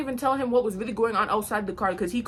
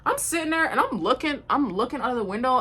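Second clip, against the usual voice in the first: ordinary talk, nothing marked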